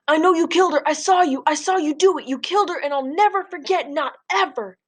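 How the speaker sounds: high-pitched desperate screaming. Acted. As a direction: keep screaming it at him, anguished. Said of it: "keep screaming".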